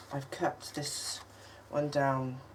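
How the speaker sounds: usual speaking voice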